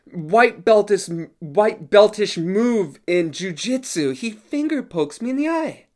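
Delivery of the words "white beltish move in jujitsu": usual speaking voice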